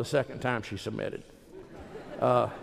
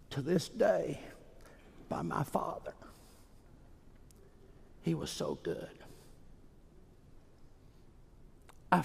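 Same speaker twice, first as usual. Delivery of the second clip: softer, near whisper; hushed confession; 5 semitones higher, 12 dB quieter than usual. Real, not acted. Preacher confessing he falls short of his father, softly. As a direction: let it fall to a humble hush.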